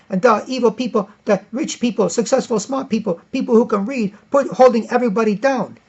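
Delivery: mock revolutionary ranting; mock zealot. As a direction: caricature a preachy revolutionary. Acted zealot quote.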